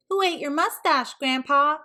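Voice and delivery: high, mocking question; teasing. Kids tease Archie about his missing mustache, high. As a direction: poke fun at the torn costume.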